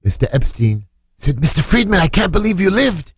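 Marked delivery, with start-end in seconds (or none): 1.4-3.1 much higher, amazed exclamation; retold amazement, pitched up; astonished. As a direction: exclaim it in disbelief. Acting out an astonished reunion.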